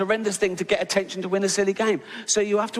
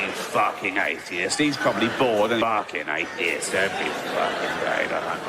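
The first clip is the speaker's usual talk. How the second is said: lazy devil growl; 9 semitones lower than usual. Acted. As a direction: drop deep and drag it out, half-bored. Devil again, bored.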